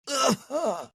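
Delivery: high-pitched strained groan; hammed-up cry of pain; mock pained groan. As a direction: groan as if just landed hard. Acts out the fallen roofer.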